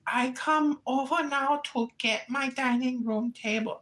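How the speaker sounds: high, whiny immigrant tenant voice; mock tenant voice. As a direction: whine like a fretful tenant. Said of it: "she was like".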